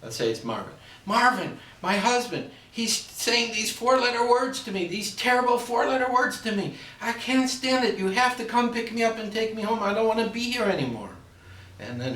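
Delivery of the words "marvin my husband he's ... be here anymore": much higher, louder wailing bride; melodramatic, near tears over his words; distraught bride. Acted. Bride begging her mother to take her home, high and wailing. Daughter complains in a joke.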